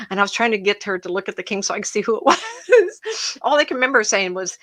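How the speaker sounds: an emotional psychic laughing as she retells the vision; laughing through tears. Real, not acted. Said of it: "I'm gonna cry on you here".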